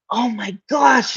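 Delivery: usual speaking voice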